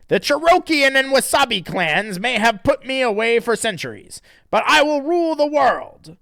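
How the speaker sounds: loud, high mock-menacing boast; high villain voice. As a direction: threaten like a cheesy cartoon villain. Evil spirit voiced.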